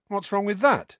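put-on defiant boy; defensive Harry. A narrator's reading. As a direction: push back sharply. Harry voiced.

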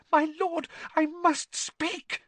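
very high, desperate servant plea; panicked. A narrator's reading. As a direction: beg to be heard in a high voice. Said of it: "panic in his voice now".

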